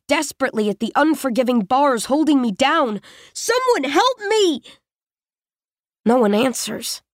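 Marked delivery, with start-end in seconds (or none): 3.3-4.6 much higher, slow, drawn-out cry for help; desperate scream. Acted. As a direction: scream till it hurts. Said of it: "I scream so hard it tears at my throat".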